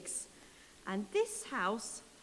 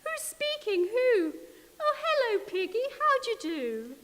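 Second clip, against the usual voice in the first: much higher, louder sing-song hello; a prim lady answering the phone; 11 semitones higher, 5 dB louder than usual. Acted. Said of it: "she said".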